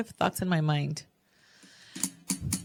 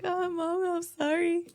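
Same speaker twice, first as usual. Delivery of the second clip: high, soft mock-tearful little girl; imitating tearful child; 10 semitones higher, 4 dB quieter, slower than usual. Daughter apologizing to mom on being found, mock tearful. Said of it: "then she starts crying".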